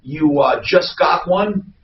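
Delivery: normal talking voice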